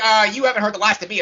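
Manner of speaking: quick, high mock villain taunt; lightly hammed-up cartoon villain; cheesy villain exit line. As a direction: ham up the escaping villain. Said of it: "and I'm like".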